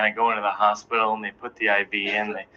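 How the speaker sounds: ordinary talk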